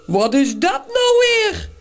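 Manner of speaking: slow, puzzled giant's grumble; put-on big creature voice; heavy, grumbling voice. A narrator's reading. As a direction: grumble it with lumbering bafflement. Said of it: "bromt Morrel met een zware stem".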